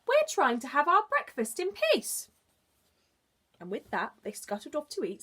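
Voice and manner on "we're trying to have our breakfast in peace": high, loud squeaky little bug voice; squeaky ladybird. Acted. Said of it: "squeaked the daddy ladybird".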